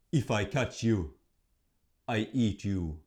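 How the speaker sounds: a hint of a menacing troll; lightly voiced troll threatening; mild troll menace; troll threat. A narrator's reading.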